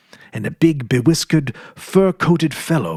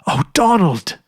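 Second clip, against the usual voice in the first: breathless joy; 6 semitones higher, 4 dB louder than usual. A narrator's reading. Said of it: "she gasped".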